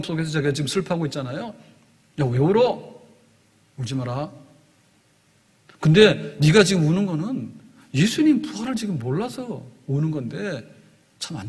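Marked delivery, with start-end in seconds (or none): 2.2-9.0 a mild rebuke, sharp then dropping off; lightly voiced angel reproaching Mary; scolding angel. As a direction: chide her for crying for nothing. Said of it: "또한 책망이라 볼 수 있어요".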